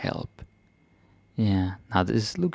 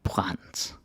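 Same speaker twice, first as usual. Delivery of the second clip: whispered; 8 dB quieter than usual. Shown as a demonstration. Whispered repeat of word.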